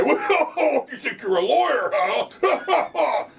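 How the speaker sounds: high, laughing sneer of a cop; mocking cop. Cop mocking the suspect for lawyering up, high sneer. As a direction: taunt the suspect like a smug officer.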